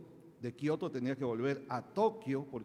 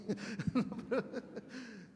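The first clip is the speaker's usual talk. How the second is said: laugh; 9 semitones higher than usual. Real, not acted. Laughing after his joke.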